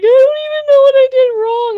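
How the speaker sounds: very high, loud mock wail; imitated bawling. Upset Melissa being held by a friend, imitated bawling. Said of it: "she's sitting there fucking bawling".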